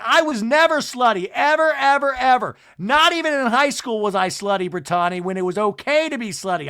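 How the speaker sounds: high, loud protest of innocence; mock screaming. Angie denying to Brittany she was slutty, mock screaming. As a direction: hammer each denial in hammed-up outrage. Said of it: "she is now screaming".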